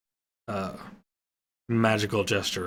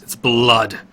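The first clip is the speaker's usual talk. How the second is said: shocked; 8 dB louder than usual. A narrator's reading. Horror at wolf's blood.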